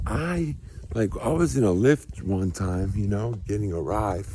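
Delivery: ordinary talk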